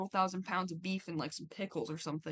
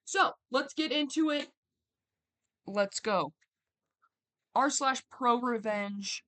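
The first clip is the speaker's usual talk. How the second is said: hyped up; 3 semitones higher, 6 dB louder, slower than usual. Real, not acted. Hyped intro to the story.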